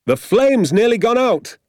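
high, excited call; father's hollered alert; urgent shout. A narrator's reading. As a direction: call out the warning, pitched right up. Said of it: "my father shouted".

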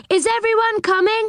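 high-pitched call down to the others; calling back. A narrator's reading. Joe asks those climbing below him, calling back. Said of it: "called back Joe".

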